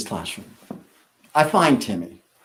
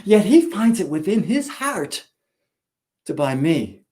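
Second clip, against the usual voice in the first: usual speaking voice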